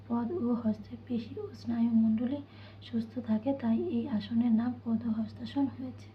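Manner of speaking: normal talking voice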